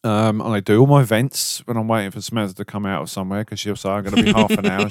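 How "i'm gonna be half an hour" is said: mimicking wife. Wife voiced in retold quote.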